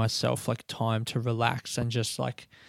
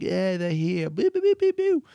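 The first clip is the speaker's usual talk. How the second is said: mock booing; 8 semitones higher, 6 dB louder than usual. Mock crowd booing sound.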